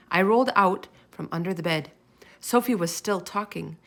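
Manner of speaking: plain reading voice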